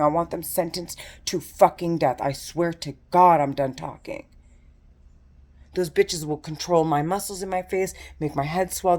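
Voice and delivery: ordinary talk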